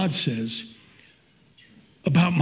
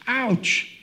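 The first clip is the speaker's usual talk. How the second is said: much higher, mock-wounded ouch; pained ouch; 7 semitones higher than usual. Real, not acted. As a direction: wince as the verse hits home. Stung by the verse.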